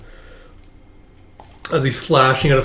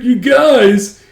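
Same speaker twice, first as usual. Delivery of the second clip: touched mock exclamation; 10 semitones higher, 5 dB louder than usual. Boss touched by his flunkies' support.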